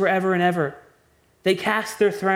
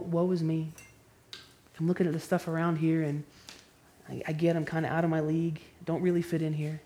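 a mock quiet, humble shrug, much softer; 7 dB quieter than usual. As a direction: say it small and casual, not distressed. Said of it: "quiet, humble".

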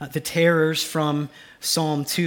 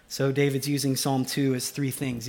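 normal talking voice

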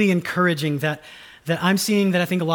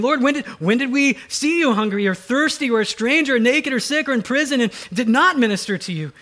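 raised, pleading voice of the bewildered righteous; pleading righteous people; 7 semitones higher, 3 dB louder than usual. Acted. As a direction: ask it like puzzled believers at judgment.

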